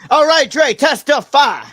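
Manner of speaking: loud, high call-out. Real, not acted. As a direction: summon the next reader with gusto. Calling on Dre to read.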